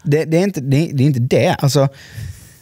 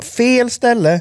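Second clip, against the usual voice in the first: mock warning; 10 semitones higher, much slower than usual. Warning snowboarder on ski slope.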